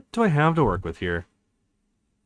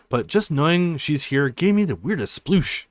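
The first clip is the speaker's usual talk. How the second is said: normal talking voice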